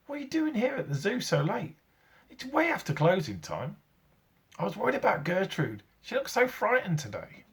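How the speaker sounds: put-on high young boy's voice, worried; a worried small boy at the zoo at night. A narrator's reading. Young Eric questions his great-uncle, high boyish voice.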